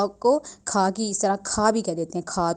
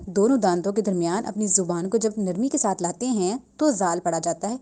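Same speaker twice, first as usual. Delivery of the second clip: ordinary talk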